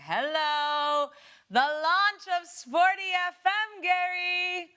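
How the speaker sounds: loud, slow call down the line; shouting into a phone. Real, not acted. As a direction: raise your voice for a bad line. Host calls guest on phone line.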